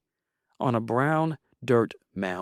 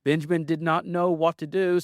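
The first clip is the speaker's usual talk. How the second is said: ordinary delivery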